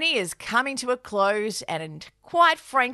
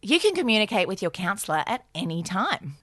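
ad read; 4 semitones lower than usual. Acted. BetterHelp ad read by both.

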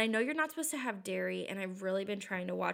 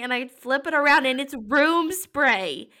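building disbelief; 3 semitones higher, 9 dB louder than usual. Real, not acted. Telling friend the spray was room spray, louder with disbelief. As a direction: let the shock swell as it dawns.

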